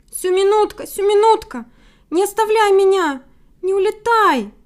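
high, loud, desperate begging; plaintive wolf cry. A narrator's reading. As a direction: plead not to be left alone. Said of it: "Ещё жалобней закричал Гаврила".

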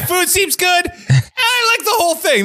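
high, silly parroting voice; mock his bland praise; mocking imitation. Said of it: "You're like".

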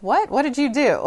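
high, loud astonished questioning; startled parent. Retold or imitated. Mother suspecting her daughter is in trouble, startled. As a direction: blurt it out in mock alarm.

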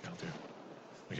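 slow breathy whisper, almost gone; fading whisper. Shown as a demonstration. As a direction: whisper it out, dying away. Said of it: "Toujours plus bas".

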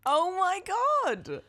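high, slow amazed exclamation. Real, not acted. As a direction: stretch it out in amazement. Amazed at Welsh name.

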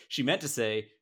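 ordinary delivery

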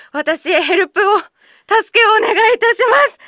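high, loud hammed-up cry for help; mock plea for help. Host begging listeners for help, mock loud plea. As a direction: beg the listeners for rescue, overplayed.

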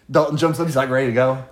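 usual speaking voice

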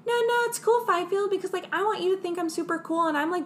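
high, eager mock nerd. Nerdy scientist begging Fifield to think he's brave, high and eager. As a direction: plead it up high like a nerd desperate for approval.

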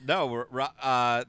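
ordinary talk, nothing marked

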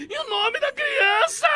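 very high, slow squeal; squeaky high voice. Retold or imitated. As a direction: squeal it like a panicked girl. Girl's squeaky desperate question.

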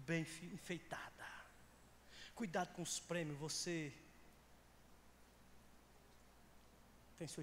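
low, slow pastoral caution; hushed, measured preacher's admonition; solemn warning. Real, not acted. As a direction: weigh each phrase slowly, dropping the voice.

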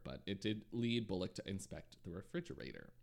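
usual speaking voice

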